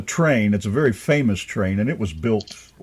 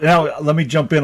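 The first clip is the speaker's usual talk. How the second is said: normal talking voice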